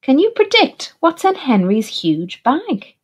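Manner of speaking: usual speaking voice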